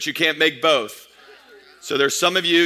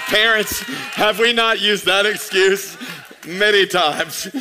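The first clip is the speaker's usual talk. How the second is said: calling out; 2 semitones higher, slower than usual. Real, not acted. Calling out to parents.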